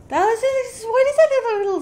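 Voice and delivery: high, wondering reaction; startled puzzlement. Real, not acted. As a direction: peer at it and ask, voice jumping up high. Spotting spiders in the game.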